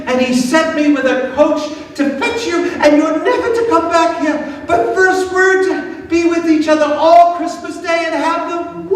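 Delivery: a lonely schoolboy delighted to hear of home; young boy's voice. A narrator's reading. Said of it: "The boy said".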